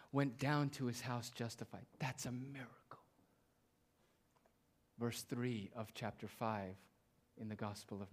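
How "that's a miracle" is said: whispered. Real, not acted. Hushed aside after the miracle.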